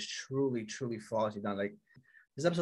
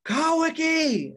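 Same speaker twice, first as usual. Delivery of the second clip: drawn-out scream, far higher and louder; screaming a name; 9 dB louder, much slower than usual. Retold or imitated. Furious villain screaming for Kawaki from the sky. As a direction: yell the name slowly up at the sky. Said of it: "starts screaming his name".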